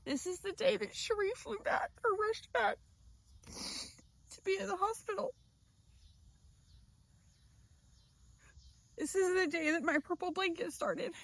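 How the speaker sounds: fighting tears, pitch breaking upward. Real, not acted. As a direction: voice cracking high as the tears come. Tearful anniversary of her stroke.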